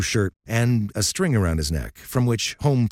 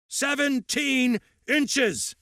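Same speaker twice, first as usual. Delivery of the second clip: bellowing; much slower than usual. Acted. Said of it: "his voice bellowing off the walls".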